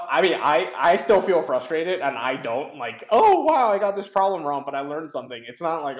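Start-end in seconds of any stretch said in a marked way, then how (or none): 3.1-5.4 hammed-up delight, high and loud, fading fast; mock cheerful. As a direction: gush it like a fake silver lining. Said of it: "all, like, rainbows and sunshine".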